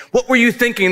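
high-pitched mock scolding of a child; exasperated parent. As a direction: demand an answer like a baffled parent. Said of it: "when you look at, like, an eight-year-old".

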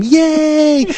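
high, drawn-out mock scream; high mock cheer; imitated group cheer. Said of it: "they all scream".

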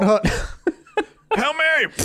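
laughing through a snap count; quarterback calling cadence. Pretend. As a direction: chant hut hut while laughing.

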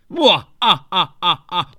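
loud hammed-up villain cackle; evil laugh.

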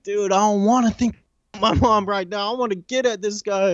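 high-pitched retold angry protest; heated protest. Inmate brushing off buddies to reach officer, heated protest. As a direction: push back in a strained high voice.

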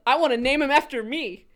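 hammed-up insistence; mock defiance. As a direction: declare it cheekily, building in volume. Acting out the writer's defiant decision.

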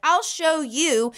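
high, drawn-out retold boast; defiant. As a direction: imitate a kid's slow, cocky comeback. Boy defying his bossy sister.

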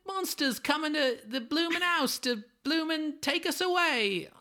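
high, loud Cockney woman voice; overdone Cockney accent, slowing at the end. Retold or imitated. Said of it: "Cockney slang she knows is bloomin'".

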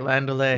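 "Land O'Lay" modelled as land-oh-lay, silent t; saying it right. Shown as a demonstration. Correcting the co-host to say it right, pronunciation demo. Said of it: "Say it right".

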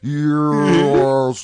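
mock slowed-down tape replay; slow-motion voice. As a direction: stretch it out like a slowed recording. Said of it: "saying it really slowly".

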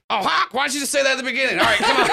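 very fast, laughing, offhand; breezy rush. Acted. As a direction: brush it off quickly. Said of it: "Herbie goes".

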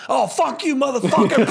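high, laughing mock insult; a bully jeering at the kid, cracking up. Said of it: "this is the way Jason sees it".

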